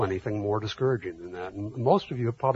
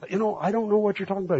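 high, fast bewildered protest; fast, high mock confusion; baffled; 10 semitones higher, faster than usual. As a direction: sound lost and confused, pitched up. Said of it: "And I'm going".

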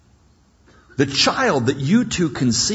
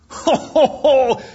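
loud high burst of delighted laughter; big acted laugh; 12 semitones higher, 5 dB louder than usual. Preacher acting out Abraham's laugh, a loud high ecstatic laugh. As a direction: laugh out loud like a thrilled old man. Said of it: "He starts laughing".

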